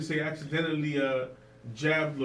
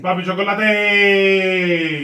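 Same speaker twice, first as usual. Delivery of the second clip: slow, loud, stretched name drop; drawn-out DJ name; 3 semitones higher, 10 dB louder, much slower than usual. Acted. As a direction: draw out the name like a radio drop.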